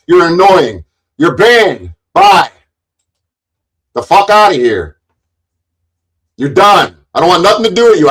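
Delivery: loud, high, shouted abuse; furious rant. Real, not acted. Streamer yelling at a troll he banned, furious. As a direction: let him have it at full volume.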